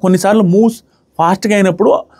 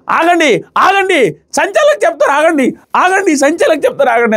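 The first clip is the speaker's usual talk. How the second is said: much higher-pitched, insistent imitation; mock urgent calling-out; 10 semitones higher than usual. Player telling others to wait for the referee, mock urgent high-pitched plea. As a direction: mimic someone frantically halting everyone, without extra volume.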